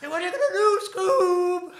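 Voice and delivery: high, loud cartoon squeal; Shaggy from Scooby-Doo. Encoring the Shaggy impression for the partner, high loud cartoon voice. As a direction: sustain the catchphrase way up high. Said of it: "my Shaggy thing's pretty good".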